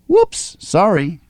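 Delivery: playful high squeak of an apology; giggly. A narrator's reading. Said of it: "Jess giggled".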